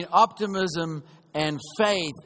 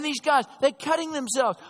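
normal talking voice

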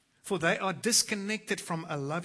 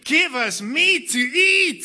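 loud, higher, slow whining demand; mock wailing; 9 semitones higher, 13 dB louder, slower than usual. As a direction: mimic the nagging crowd. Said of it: "They keep wailing to me".

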